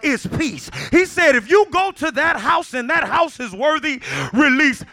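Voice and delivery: loud, high-pitched, driving sermon shout; preaching at full force. Real, not acted. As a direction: build to a thundering full-voiced proclamation.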